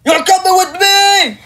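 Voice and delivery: snatch them with a booming threat; menacing shout. Acted. Villain grabs victim.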